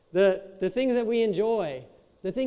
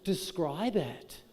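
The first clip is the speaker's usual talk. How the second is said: surfer bro nudge; much slower than usual. Retold or imitated. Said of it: "they'd be like".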